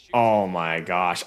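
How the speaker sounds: slow, low, hushed oh my gosh; awed under the breath; stunned hush. Real, not acted. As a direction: caught breathless by what he sees. Caster stunned by comeback.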